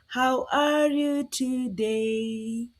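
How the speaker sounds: drawn-out friendly question; slow, clear ask to the class; slow teacher question. Shown as a demonstration. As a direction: stretch it out for little ones. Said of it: "slow question to kids".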